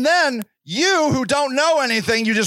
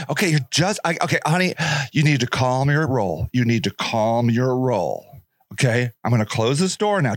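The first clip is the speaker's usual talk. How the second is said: cold dismissive clerk; 8 semitones lower than usual. Retold or imitated. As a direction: shut the door on her with a bored sigh. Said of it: "shelter worker shuts door".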